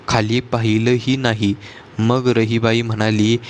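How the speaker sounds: usual speaking voice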